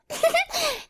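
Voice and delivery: high giddy giggle; giggle after belch. A narrator's reading. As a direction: giggle it off sheepishly. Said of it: "belched loudly".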